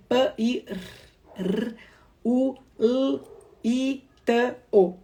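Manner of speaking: "Pã e r, r, u, l, i, t, o" said as separate sounds with pauses between; sounds split apart. Shown as a demonstration. Teacher to teachers, segmenting pirulito into isolated sounds. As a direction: sound out "pirulito" one sound at a time. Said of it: "identificar e manipular os sons ali bem isolados na palavra".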